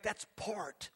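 a hint of a calm divine answer; voice of the Lord. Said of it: "the Lord might say something like".

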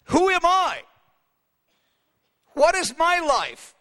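high, loud, drawn-out humble questions; humble disbelief. Acted. David to Saul on being offered his daughter, humble disbelief. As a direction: marvel at your own unworthiness. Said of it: "his humility".

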